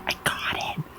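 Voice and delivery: unvoiced whisper, as if to friends far away; mouthed far-off signal. Signalling to distant friends with the circlet, whispered. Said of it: "when you're standing really far away from your friends".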